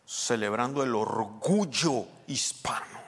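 slow, drawn-out TV proclamation with "orgullo" stretched; TV presenter imitation. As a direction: mimic a television host savouring each word. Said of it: "Algunos dicen así en la televisión".

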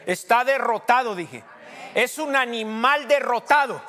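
loud, high cry; preaching shout. Real, not acted. Pastor proclaiming Satan defeated, loud shout. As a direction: hammer it home to the church.

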